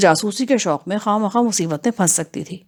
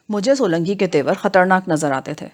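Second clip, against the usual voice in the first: plain reading voice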